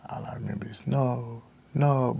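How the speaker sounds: soft whisper into a faint "no"; barely able to say it; hushed breaking. Real, not acted. Recalls hearing no heartbeat.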